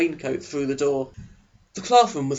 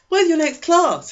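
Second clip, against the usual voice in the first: put-on higher, louder eager teen boy voice; eager Eric; 6 dB louder, slower than usual. Eric leaning over to the new girl, eager and high. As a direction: pitch him up high and keen, a chess-club type.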